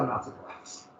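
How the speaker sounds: breathy whispered aside, deadpan; whispered reply. Retold or imitated. Teacher retelling his dry reply to the waitress, whispered. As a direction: whisper it dryly, not surprised at all. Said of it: "And I said".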